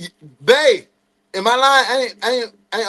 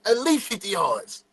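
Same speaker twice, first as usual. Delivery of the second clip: normal talking voice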